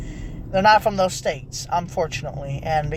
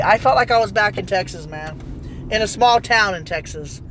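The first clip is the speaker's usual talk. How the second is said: usual speaking voice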